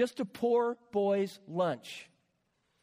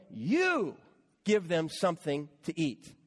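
"You" set apart and stressed, then the rest lower; emphatic you; 3 semitones lower than usual. Shown as a demonstration. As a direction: lean hard on "You" and pause before the rest. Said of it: "you" is emphatic".

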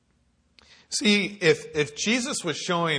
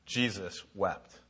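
solemn reading; 5 semitones lower, 8 dB quieter, much slower than usual. Acted. Reading the shortest, weighty verse.

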